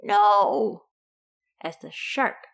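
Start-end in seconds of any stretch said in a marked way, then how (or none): 0.0-0.9 loud, drawn-out cry; loud protesting yell. A narrator's reading. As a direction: yell it in storybook dismay. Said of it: "and Len yelled".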